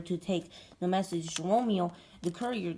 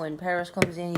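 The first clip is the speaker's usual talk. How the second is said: ordinary talk, nothing marked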